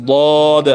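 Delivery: ضاد heavy and full from inside; thick voice. Shown as a demonstration. Teacher showing pupils a thick ضاد. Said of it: "یہ موٹی آواز سے پڑھیں گے".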